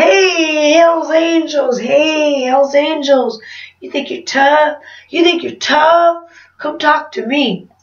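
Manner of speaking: mock taunting call. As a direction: re-enact a drunken challenge to tough bikers. Drunk, calling out bikers, retold.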